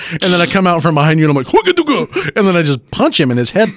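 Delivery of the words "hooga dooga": mock battle cry. Fighter battle cry in game.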